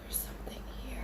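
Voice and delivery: barely audible whispered warning; fearful whisper. Retold or imitated. Warning a friend of something upstairs, fearful whisper. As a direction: whisper as if it might hear you.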